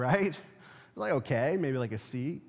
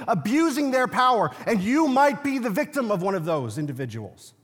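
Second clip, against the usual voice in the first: raised, louder preaching voice, building; full-force preaching; 8 semitones higher, 6 dB louder than usual. Acted. Preacher denouncing abusive leaders to his congregation, loud. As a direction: preach it hard to the room.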